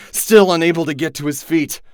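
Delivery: ordinary delivery, nothing marked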